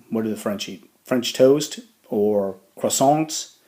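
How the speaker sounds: usual speaking voice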